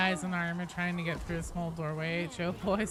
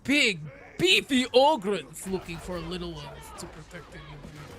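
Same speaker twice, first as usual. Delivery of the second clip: big beefy ogre, trailing away at the end; Ogryn voice; 3 semitones lower than usual. Acted. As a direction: play a hulking Ogryn, fading off on the last words. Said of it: "I really nail the Ogryn voice".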